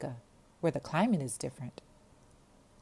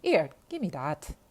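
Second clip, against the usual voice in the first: put-on bossy Jamaican girl; 7 dB louder than usual. Jamaican girl grabs the receiver.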